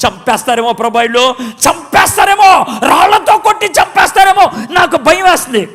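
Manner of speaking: high, loud, panicked cry; frightened shouting. Acted. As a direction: yell out the fear of being stoned. Moses fearing being stoned.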